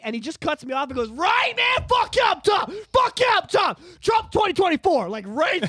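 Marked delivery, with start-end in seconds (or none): 1.1-5.1 much higher, louder mock yell of a fired-up fan; high, loud retold shout on a busy train; imitated yelling. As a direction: ham up a stranger bellowing his support. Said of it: "he's yelling this on the go train".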